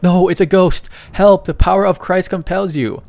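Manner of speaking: high, loud mock-terrified yelling; hammed-up panic. Brothers crying out at a supposed ghost, hammed-up panic. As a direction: yell it high like a comic exorcist in a fright.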